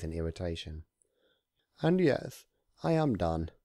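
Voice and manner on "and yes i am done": retort. Acted. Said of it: "Discord retorted".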